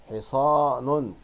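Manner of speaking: "حصان" drawn out slowly as "hi-saa-nun"; slow teacher's model of حصان with nunation; tanween damma ending. Shown as a demonstration. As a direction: stretch حصان, landing on a loud -un. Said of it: "تنوين بالضم أن".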